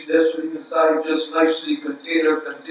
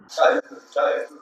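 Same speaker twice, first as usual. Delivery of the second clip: normal talking voice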